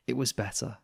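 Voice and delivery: usual speaking voice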